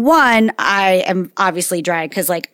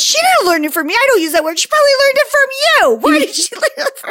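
much higher, fast mock indignant mom; grandma's indignant denial; faster than usual. Daughter mimics her mom pushing blame back, shrill indignation. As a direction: squeal the denial like an offended grandmother. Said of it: "Mom goes".

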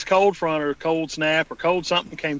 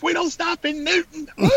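mock yokel cry, pitched way up; imitated country-bumpkin holler; 12 semitones higher than usual. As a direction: whoop it like a hammed-up bumpkin. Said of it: "And Will goes".

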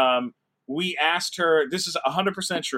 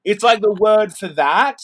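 higher, louder, lightly voiced bubbly British guest; imitating Chloe; 3 semitones higher, 6 dB louder than usual. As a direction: mimic her eager ramble. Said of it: "And she said".

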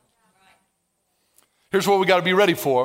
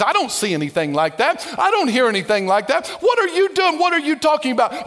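higher, pestering questions; nosy outer man; 5 semitones higher than usual. Pretend. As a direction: needle yourself like a nosy busybody. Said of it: "your outer man will say".

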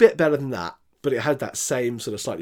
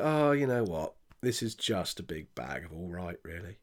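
retold resigned verdict; 6 semitones lower, 8 dB quieter than usual. Reviewer telling co-host of losing interest in the film, resigned. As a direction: lower and much softer, deflated.

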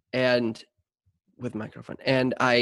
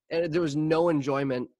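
normal talking voice

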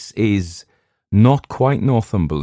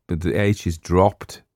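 usual speaking voice

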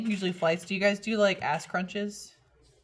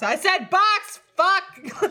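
high, loud frustrated outburst; sudden explosive yell; 12 semitones higher, 7 dB louder, much slower than usual. Acted. Acting out self-correction after a fluffed word, sudden yell. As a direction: go from zero to a hundred in volume. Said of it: "from zero to a hundred volume-wise".

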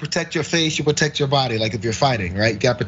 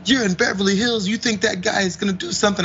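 mocking friends; 5 semitones higher than usual. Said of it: "And they're like".

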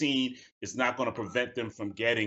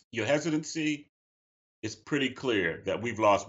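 ordinary talk, nothing marked

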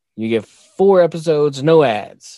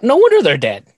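much higher and louder punchline; mock exclaim; 10 semitones higher, 6 dB louder than usual. Joking back at his daughter about ancient Egyptians, mock shocked shout. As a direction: blurt the joke like a shocked yell. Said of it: "I'm like".

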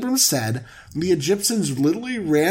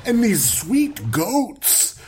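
carefree bro; 7 semitones higher than usual. Pretend. Abram lounging in luxury.

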